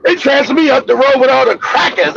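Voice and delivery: usual speaking voice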